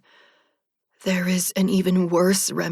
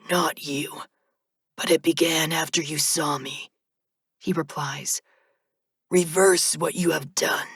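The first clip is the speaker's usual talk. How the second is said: reaper monotone. A narrator's reading. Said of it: "his monotone voice".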